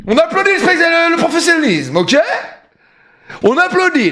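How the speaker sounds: high-pitched, boastful excitement; triumphant crowing. Real, not acted. Streamer demanding applause for his bingo, triumphant crowing.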